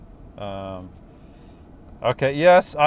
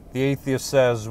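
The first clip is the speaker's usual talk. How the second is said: ordinary talk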